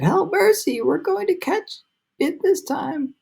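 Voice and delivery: high, slow terrified plea; panicked cry. A narrator's reading. Travelers facing the storm cloud, high terrified cry. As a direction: cry for mercy in terror.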